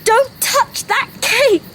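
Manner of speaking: high, slow warning cry; re-enacted shout. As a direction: call out the warning, punching each word. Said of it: "if you hadn't shouted out".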